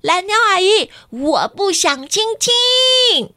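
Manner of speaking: put-on high, loud child's protest; loud refusal. A narrator's reading. Ruixi loudly refusing aunt Bluebird's kiss, high and drawn out. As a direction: refuse it loudly, dragging the words. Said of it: "很大声地说".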